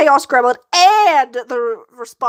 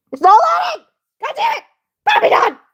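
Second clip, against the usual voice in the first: panicked shout; 13 semitones higher, faster than usual. Real, not acted. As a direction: yelp in real alarm as the stream dies. Live stream fails to load.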